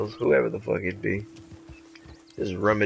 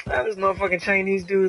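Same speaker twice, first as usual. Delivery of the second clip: mock casual curse; 7 semitones higher than usual.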